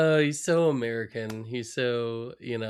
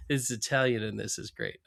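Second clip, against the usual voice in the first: usual speaking voice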